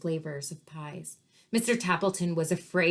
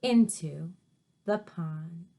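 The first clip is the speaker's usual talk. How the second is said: usual speaking voice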